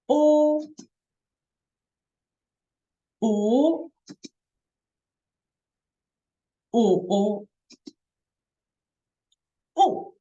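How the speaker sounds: o through first, second, third and fourth tones. Shown as a demonstration. Teacher drilling the four tones on o. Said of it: "we practice".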